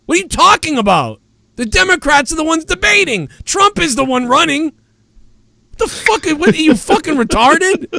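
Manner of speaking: high, incredulous yelling at the chat; angry rant. Real, not acted. Streamer yelling at a chat commenter, angry and incredulous. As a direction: vent at the idiot in chat.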